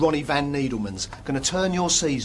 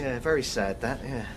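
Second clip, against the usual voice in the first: softer, lower, dropped-away sympathy; muted regret; 6 semitones lower, 7 dB quieter than usual. Acted. Agent brushing off a dead player's fate, muted regret. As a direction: back off softly with token regret.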